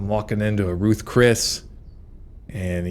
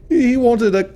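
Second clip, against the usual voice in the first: high, slow scornful mockery; mock the customer's order; high mocking sneer; 13 semitones higher, 4 dB louder than usual. Snobby barista mocking customer.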